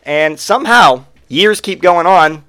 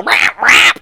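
higher, abrupt croaky cat cries; cat squawk imitation; 8 semitones higher, 3 dB louder than usual. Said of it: "it's going".

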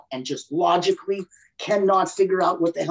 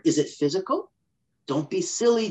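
ordinary delivery

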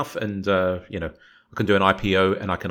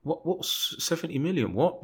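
high, softer imitated disbelief; mock incredulity; 7 semitones higher, 6 dB quieter than usual. Player's family hearing the club's offer, mock incredulity. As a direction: play baffled at a derisory figure.

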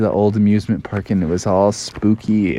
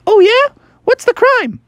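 squeaky Robin voice; 5 dB louder than usual. Acted. Robin asks, very high.

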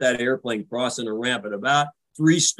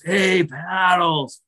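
slow, rasping, higher hey Paddles; wheezing injured greeting; 6 semitones higher, much slower than usual. Retold or imitated. Beaten-up Keith greets the landing officer from the table, rasping. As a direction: push it out through battered lungs. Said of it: "he's wheezing and rasping".

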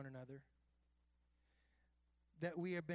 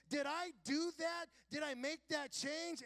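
shouting; 11 semitones higher, 5 dB louder, slower than usual. Retold or imitated. Pastor asks himself, shouting.

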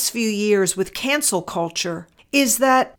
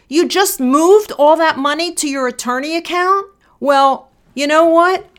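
imitating his higher, louder outburst; furious husband; 6 semitones higher, 5 dB louder than usual. Wife retelling husband's shout over the money, angry. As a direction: retell his angry burst at her, pitched up. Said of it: "And he was furious".

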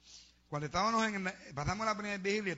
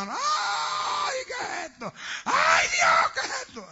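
hammed-up high, very loud shriek of terror; panicked screaming; 12 dB louder, much slower than usual. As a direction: scream in mock horror. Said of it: "Gritando como una". (chillido de pánico imitado)

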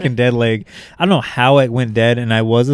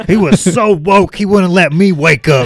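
high-pitched aggrieved caricature; hammed-up indignant complaint; ranting neighbor; 6 semitones higher, 3 dB louder than usual. Co-host continuing the imagined neighbor's rant, outraged. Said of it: "He's like".

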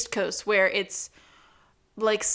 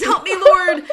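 much higher, louder cry for help; desperate plea; 9 semitones higher, 12 dB louder than usual. Retold or imitated. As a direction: beg the heavens mid-rapid. This pandemonium.

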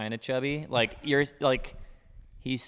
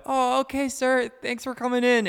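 much higher, sugary polite brush-off; sing-song customer service voice, much higher; fake polite; 13 semitones higher, 5 dB louder than usual. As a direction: chirp it back at him with mock politeness. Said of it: "And I go".

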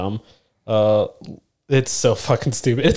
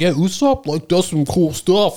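higher, drawn-out fanboy imitation; mock fan; 6 semitones higher, slower than usual. Mimicking fans who praise Usopp, mocking. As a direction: imitate a fan, pitched up and slow.

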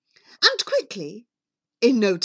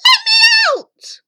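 high, piercing scream; bellowed plea; 12 dB louder than usual. Acted. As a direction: shriek to be let out. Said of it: "She bellowed".

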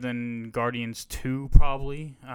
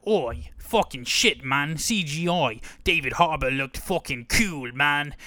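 British bloke again; 2 semitones higher, 4 dB louder than usual. Retold or imitated. Same British bloke voice returns.

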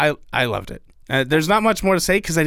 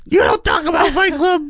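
high, loud mock little-kid voice; Lil Hannah; 4 dB louder than usual. Cohost imitates little Hannah talking Fight Club, high silly kid voice. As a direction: pipe up as a silly Brooklyn kid. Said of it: "I don't know why I sound like this".

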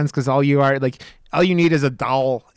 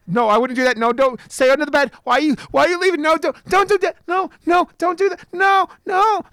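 higher and higher, anxious pleading; tense pleading; 11 semitones higher than usual. Retold or imitated. Horror fan pleading with the character under the bed, rising panic. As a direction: beg the character to stop, climbing. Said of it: "you're just like".

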